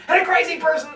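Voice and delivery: sudden high-pitched outburst; crazy person. Shown as a demonstration. Speaker mocking forced vocal variety, crazy high outburst. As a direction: leap way up high, hammed-up. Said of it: "then you sound schizo".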